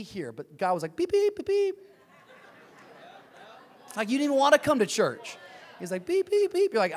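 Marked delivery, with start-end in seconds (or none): 0.9-1.7 high mock alarm beeps; God beeping. Pastor voicing God as the seatbelt alarm, high beeps. 6.1-6.7 high mock alarm beeps; God beeping. Pastor voicing God as the seatbelt alarm, high beeps.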